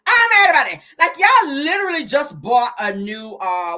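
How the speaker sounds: indignant mock rant. Real, not acted. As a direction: punch the second take up. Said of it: "I'm mad about that now".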